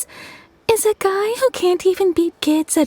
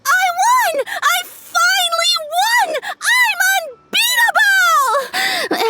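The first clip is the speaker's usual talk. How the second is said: high, excited victory cheer; bouncing with triumph, shrieking high; gleeful yelling. Retold or imitated. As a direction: shout it like a kid who finally won. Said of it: "he started jumping around and yelling".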